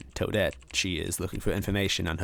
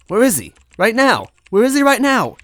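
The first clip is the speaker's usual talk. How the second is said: much higher and louder, urgent pleading; desperate demand; 10 dB louder than usual. A narrator's reading. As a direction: push hard, voice climbing high. Luigi desperate for his brother.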